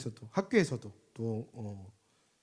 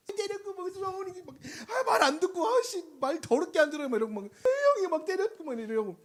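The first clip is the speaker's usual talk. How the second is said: higher, louder, agitated griping; worked-up complaint; 11 semitones higher, 5 dB louder than usual. Retold or imitated. Pastor acting a fed-up parent complaining about kids, higher and louder. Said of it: "이렇게, 이렇게 되는 거야".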